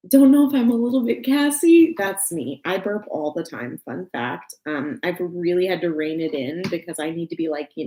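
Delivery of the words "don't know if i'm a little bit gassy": higher, louder quote of Anna; bubbly cartoon princess line; playful Anna voice, higher and louder; Anna impression. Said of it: "that part where she's like".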